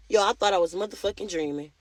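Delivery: plain reading voice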